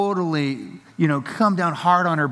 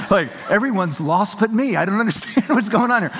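laughing; 6 semitones higher than usual. Retold or imitated. Father retelling his son's complaint, laughing and higher. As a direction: giggle through the mock complaint.

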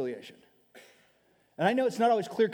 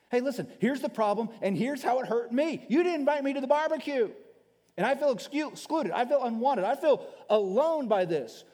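hurt friend; 6 semitones higher than usual. Pretend. Voices a wounded friend confessing.

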